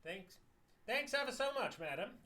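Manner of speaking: high, loud grovelling thanks; high, loud fawning trader voice; fawning native trader. Acted. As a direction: gush like a flattering merchant.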